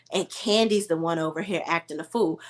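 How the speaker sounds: ordinary talk, nothing marked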